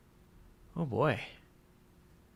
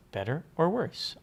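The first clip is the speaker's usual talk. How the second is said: louder, higher "Better or worse?"; louder test take; 5 semitones higher, 6 dB louder than usual. Shown as a demonstration. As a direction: belt the test line out. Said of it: "vocal compressor's working".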